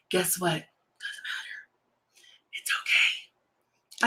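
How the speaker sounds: whispered reassurance. Real, not acted. Reassuring herself about uneven brows.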